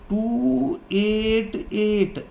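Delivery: each number stretched out slowly; drawn-out counting. Real, not acted. Teacher giving pupils the electron configuration, drawn out slowly. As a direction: say it very slowly, holding every number.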